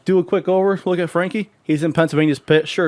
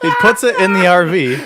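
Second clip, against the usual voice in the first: helpless laughter; 5 dB louder than usual. Real, not acted. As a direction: barely get it out through laughter. Breaking up while telling story.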